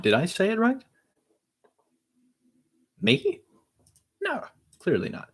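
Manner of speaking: very high, squeaky-rising incredulity; mock disbelief. Asking oneself if the take was right, mock disbelief. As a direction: question it in exaggerated high-pitched disbelief.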